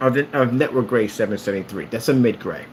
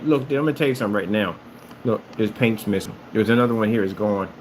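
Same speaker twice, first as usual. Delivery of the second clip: normal talking voice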